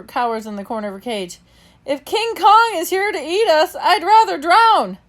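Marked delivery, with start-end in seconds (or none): 1.9-5.0 high, loud frightened cry; panicked wail. Acted. A terrified cage mate wails about being eaten. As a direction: cry out in dread.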